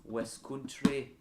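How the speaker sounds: slow West Country try that comes out Scottish; slow, Scottish-sounding mock West Country; West Country accent attempt. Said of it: "that sounds more Scottish than West Country".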